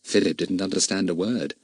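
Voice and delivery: plain reading voice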